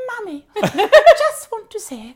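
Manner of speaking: high, loud, slow mock-posh little girl; posh Queen-like English. Small daughter recording a message for mum, posh Queen-like English. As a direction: exaggerate a child's royal-sounding English, laughing. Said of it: "talks like the Queen".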